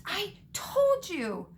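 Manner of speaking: high, drawn-out gasped reproach; gasping. A narrator's reading. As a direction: stretch it out high, as if short of breath. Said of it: "gasped, clutching the stitch".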